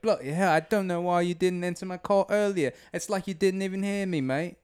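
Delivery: high, indignant British beagle; British-accented dog griping at the owner. Acted. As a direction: complain in a put-on British street accent. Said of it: "I imagine him talking like".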